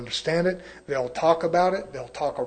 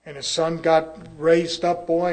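normal talking voice

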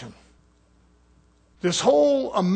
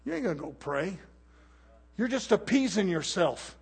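muttered rebuke; 8 dB quieter than usual. Retold or imitated. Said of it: "I'm saying".

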